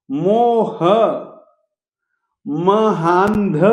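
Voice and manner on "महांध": महांध very slow, nasal before ध; slow Sanskrit word; careful pronunciation. Shown as a demonstration. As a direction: stretch out महांध clearly. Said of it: "अनुस्वार ध के पहले आएगा".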